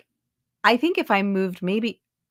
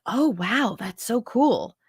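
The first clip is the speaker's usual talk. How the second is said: higher, mildly wowed imitation; imitated impressed reaction; 5 semitones higher, slower than usual. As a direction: play people impressed by your hometown. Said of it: "people are like".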